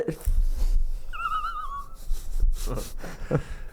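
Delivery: high, breathy burst of laughter; real laugh. Real, not acted. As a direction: crack up at the joke.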